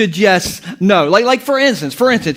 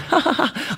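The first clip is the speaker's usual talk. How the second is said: light, higher "ha ha ha"; imitated laughter; 4 semitones higher, 7 dB quieter than usual. Kids laughing in the bedroom, soft imitated giggle. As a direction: mimic kids giggling, softly. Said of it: "they were just, like, laughing".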